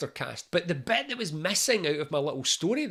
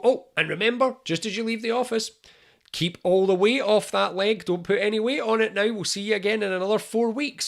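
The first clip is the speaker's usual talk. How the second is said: raised, higher voice calling across the office; calling after someone; 5 semitones higher, 4 dB louder than usual. Acted. Doctor calling after the patient as they walk out, raised voice. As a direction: call it out to them as they leave the room.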